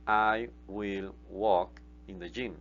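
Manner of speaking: very slow, word-by-word English; slow model sentence. Shown as a demonstration. Teacher modelling the future tense slowly for students. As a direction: lay out each word for learners. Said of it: "yo caminaré en el gimnasio".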